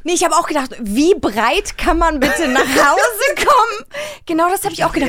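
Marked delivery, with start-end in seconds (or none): 0.0-3.9 laughing. Real, not acted. Laughing over drunk charade.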